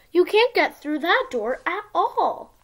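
usual speaking voice

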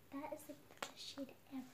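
soft fast ASMR whisper; breathy whisper, close to the mic. Real, not acted. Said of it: "this is A S M R version".

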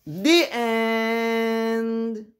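high, drawn-out "The end"; sing-song close to the book; storybook ending. Acted.

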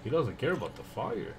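ordinary talk, nothing marked

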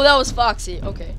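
ordinary talk, nothing marked

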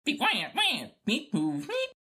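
high, bleating robot beeps and wails; droid beeps. Acted. R2 protesting his message, whining droid beeps. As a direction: whine and chirp like a fretful droid.

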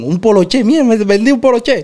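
thrilled seller after his first sale; retold excitement. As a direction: crow about the sale like it was a million. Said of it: "como que hubiera vendido un millón".

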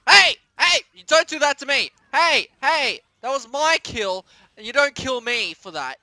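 loud indignant yelling; angry shout. Real, not acted. Gamer yelling at a rival who stole his kill, angry. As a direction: tell the rival off, furious.

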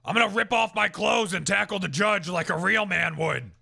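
mildly hammed-up courtroom bravado; lightly voiced blustering crank; blustering defendant. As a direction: boast it loud like a self-styled rebel. Plays conspiracy-minded defendant in character.

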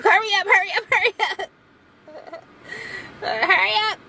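high, urgent pleading "hurry up"; high mock-urgent plea; urging the AC. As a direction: beg the air conditioner in a high voice. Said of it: "I'm like".

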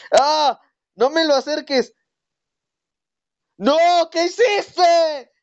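very high, strained panicked cry; panicked scream. Real, not acted. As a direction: scream in panic, near crying.

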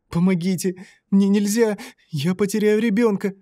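high, straining moan of pleading; moaning plea. A narrator's reading. Lyolya begs Martyn while holding up her husband, high moaning. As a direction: beg through the effort of holding him. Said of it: "простонала она".

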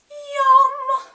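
high, loud delighted yum. Real, not acted. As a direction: squeal it with delight. Tasting, loud exclaim.

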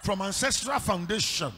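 loud, preached proclamation with rising shout; full-force declaration. Shown as a demonstration. Pastor leads congregation in a loud declaration. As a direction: belt it out like a fiery pastor leading the church. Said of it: "Somebody stand on your feet and say".

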